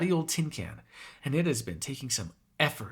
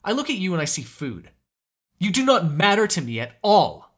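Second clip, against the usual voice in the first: raised, emphatic angry tirade; heated, higher-pitched rant; furious outburst; 5 semitones higher, 5 dB louder than usual. Acted. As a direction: hammer each point home, loud and exasperated.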